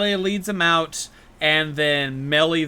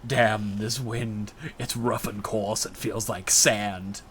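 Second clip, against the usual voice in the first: lower, softer and slow; mock gruff guard; 6 semitones lower, 8 dB quieter, slower than usual. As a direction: grumble it at the wind. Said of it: "he says".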